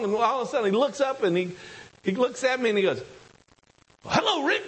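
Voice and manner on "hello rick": a high, light South African greeting; South African accent. Retold or imitated. Preacher calling Rick out from the front, South African accent. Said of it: "he speaks that South African accent".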